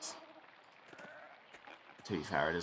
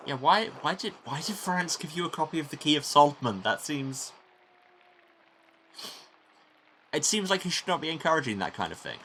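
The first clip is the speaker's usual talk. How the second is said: louder, higher, incredulous questioning; baffled objection; 8 semitones higher, 6 dB louder than usual. Real, not acted. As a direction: question it loudly, stumbling over "why". Player reacts, baffled by character's gift.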